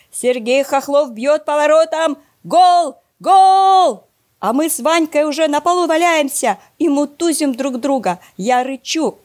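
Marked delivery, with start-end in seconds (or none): 0.1-4.0 higher, louder with every word, ending in a screamed goal; a TV commentator going wild at a goal; sports commentator screaming a goal, building to a roar; commentator yelling goal. Acted. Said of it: "орёт".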